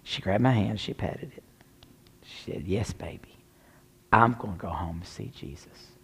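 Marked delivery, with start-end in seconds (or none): 2.7-5.9 low, hushed motherly comfort; tender reassurance. Retold or imitated. Dying mother pats her child's hand, tender low reassurance. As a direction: lower the voice and soothe softly.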